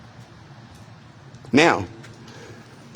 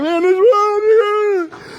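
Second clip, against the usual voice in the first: high, loud, stunned exclamation; shocked outcry; 3 dB louder, much slower than usual. Retold or imitated. Retelling the gunman kicking in the door, a high shocked outcry. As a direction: gasp it out in disbelief. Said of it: "I'm like".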